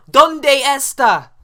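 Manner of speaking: drawn-out tourist Spanish, hammed-up; mock Spanish. Mocking how people ask where you're from, in hammed-up Spanish.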